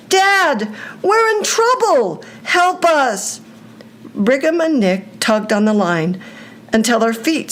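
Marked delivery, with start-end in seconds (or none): 0.1-3.4 high, strained shout for help; calling for help. A narrator's reading. Brigham calling out to Dad from the lake, desperate shout. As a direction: force the cry out to be heard far off. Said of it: "forced enough air out of his mouth to call out".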